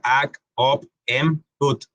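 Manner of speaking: "ac, op, em, ut" each closed on its consonant; vowel-first syllables. Shown as a demonstration. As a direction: sound out each reversed syllable, "ac, op, em, ut". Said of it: "primero la vocal y después la consonante".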